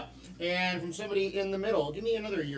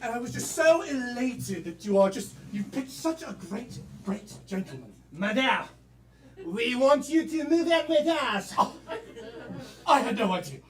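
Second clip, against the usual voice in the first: higher hammed-up French accent; mock French accent; 6 semitones higher, 4 dB louder than usual. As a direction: swoon in an exaggerated French accent. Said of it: "British, French, and German".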